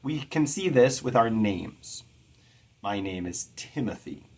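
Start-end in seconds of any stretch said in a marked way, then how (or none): 3.6-4.2 Timothy with the stress on Tim; stress on first syllable. Shown as a demonstration. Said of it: "I stress the Tim more than".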